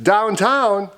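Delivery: lightly acted, high-pitched answer; calling out news. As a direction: announce it for young listeners. Story character calls out an answer.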